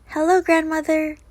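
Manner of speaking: high call through the door. Acted. Girl calling hello to grandmother, high. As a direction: call cheerily into the house. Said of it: "she called".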